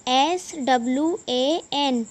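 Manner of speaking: slow letter-by-letter spelling; teacher slowly reading out letters. Shown as a demonstration. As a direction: spell it slowly for young pupils, each letter drawn out. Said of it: "spelling पढ़ते हैं".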